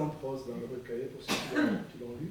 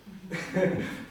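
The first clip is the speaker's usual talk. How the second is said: short real chuckle; brief laugh; 4 semitones higher than usual. Real, not acted. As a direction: chuckle briefly at your own remark. Rit en avouant aimer les étourderies. (petit rire spontané)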